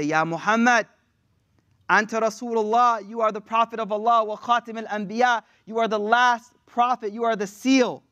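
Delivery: urgent, emphatic address; impassioned appeal. Acted. The people appealing to Muhammad as final prophet, impassioned. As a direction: call out to the last hope.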